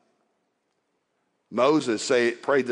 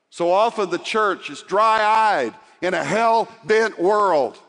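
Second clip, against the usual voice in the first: louder, higher preaching, urging the church; pleading with the church to care, raised voice; fervent preaching; 8 semitones higher, 4 dB louder than usual. Acted. Preaching at full force.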